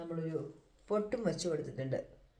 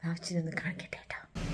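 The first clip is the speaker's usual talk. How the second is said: secretive hushed whisper; 3 dB quieter than usual. Real, not acted. Mother whispering to viewers before showing child the snow, whispered.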